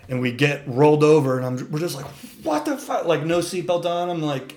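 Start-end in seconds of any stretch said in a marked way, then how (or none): 2.4-3.1 a high, startled what the fuck; stunned. Retold or imitated. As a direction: blurt it out in shock. Waking upside down in crashed truck.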